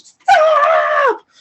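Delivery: very high, loud, ringing shouted stop; huge stop. Retold or imitated. Inner voice halting her, huge high shout. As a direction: shout stop as if it fills your head. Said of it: "I hear that's, like, huge".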